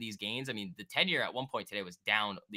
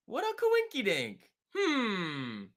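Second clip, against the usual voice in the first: much higher, sing-song coy voice; mock-innocent; 11 semitones higher, 4 dB louder, much slower than usual. Real, not acted. Streamer feigning innocence about phones, mock-innocent. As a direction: play dumb with a high lilt.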